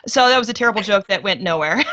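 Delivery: ordinary delivery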